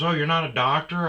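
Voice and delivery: high, loud surprised woman; surprised gym lady. Retold or imitated. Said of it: "She goes".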